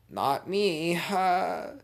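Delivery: high, loud, playful feigned surprise; mock innocence. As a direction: rise high and draw it out, teasing. Mock surprise at own trick.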